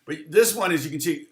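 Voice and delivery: ordinary delivery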